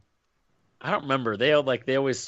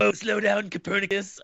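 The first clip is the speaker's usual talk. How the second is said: higher mock teasing voice; monster teasing; 9 semitones higher than usual. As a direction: tease him like a smug monster.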